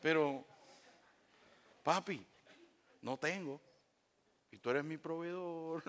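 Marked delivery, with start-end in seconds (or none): 1.8-5.8 soft, meek little request; humble child's plea. Acted. Child asking provider dad for money, soft and humble. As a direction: ask papi quietly, trustingly.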